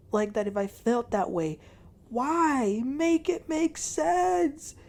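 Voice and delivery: high-pitched, pleading retold upset; mock hysterical pleading, pitched high; imitated hysterics. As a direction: imitate someone crying out in desperate protest. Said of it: "She was, like, very, very, very ... hysterical".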